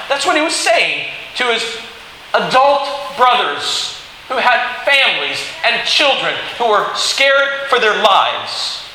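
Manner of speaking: normal talking voice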